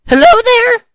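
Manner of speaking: loud, high, slow hesitant hello; stammered greeting. A narrator's reading. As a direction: falter loudly into a microphone. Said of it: "Joey stammered into the microphone".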